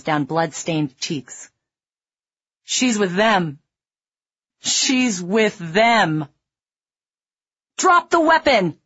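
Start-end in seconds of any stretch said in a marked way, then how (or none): 2.6-3.6 higher, tearful plea; crying plea. A narrator's reading. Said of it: "he was crying". 4.6-6.3 very slow, tearful, drawn out; weeping man repeating his desperate warning; slow crying plea. A narrator's reading. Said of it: "he was crying". 7.8-8.8 loud, high order; commanding shout. A narrator's reading. Marine ordering armed man.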